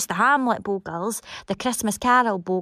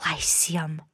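whispered; 5 semitones lower, 6 dB quieter than usual. Acted. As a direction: whisper the name, softer. Confiding aside to the theatre.

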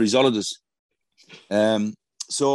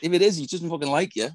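higher put-on voice for the quoted mate; acted quote; 7 semitones higher than usual. Mate warning about the dog, pitched-up put-on voice. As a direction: plays the mate's blunt warning, pitched up.

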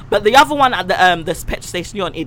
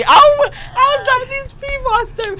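exasperated high voice. Retold or imitated.

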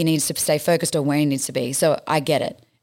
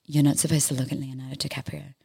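discreet warning; 6 dB quieter than usual. Retold or imitated. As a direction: imitate a colleague quietly cautioning a newcomer. Girl discreetly warns her on set.

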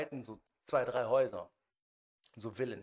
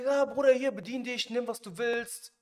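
a much higher, breezy host offering everything; easygoing host; 10 semitones higher, 7 dB louder than usual. Retold or imitated. Host at the messy villa welcoming his guest, high and airy. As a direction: sing it out like a laid-back party host.

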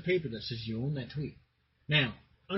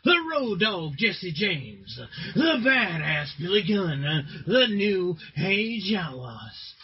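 booming ringmaster announcement; 2 semitones higher, 3 dB louder than usual. Acted. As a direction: draw out each name like an arena announcer. Wrestling show intro announcement.

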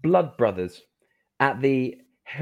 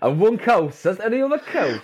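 higher, hammed-up Geordie mimicry; mock northeastern accent; 6 semitones higher than usual. Co-host mimics the guest's cow joke, mock Geordie accent. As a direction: tease with an exaggerated Newcastle accent. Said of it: "thinking about the accent too much".

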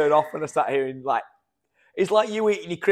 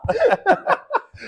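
high laugh; 3 dB louder than usual. Real, not acted. Laughing hard.

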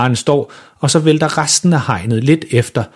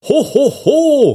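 meget højt, langsomt ho ho ho; julemands-ho-ho; much slower than usual. A narrator's reading. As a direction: spil julemanden langt væk. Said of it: "råber far". (overspillet julemandsråb)

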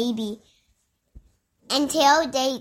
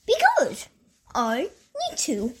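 squeaky tiger voice; 2 semitones higher than usual. Acted. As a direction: squeak the first word. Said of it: "he's like".

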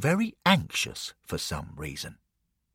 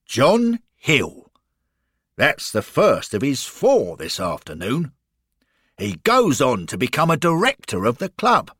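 lightly voiced, punchy guide, higher and louder; Arthur the keen guide; 4 semitones higher, 4 dB louder than usual. Guide telling Lloyd about the scorer, keen. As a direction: show him round with hearty enthusiasm.

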